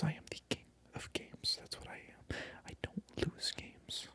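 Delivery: usual speaking voice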